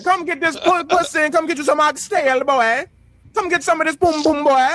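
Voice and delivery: put-on high, teasing older Caribbean woman; Caribbean woman accent. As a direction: coax him like an older Caribbean auntie. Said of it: "older Caribbean women".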